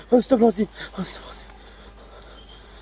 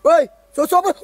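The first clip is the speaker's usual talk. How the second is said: ordinary talk, nothing marked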